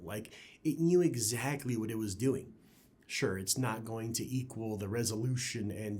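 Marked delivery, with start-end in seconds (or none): none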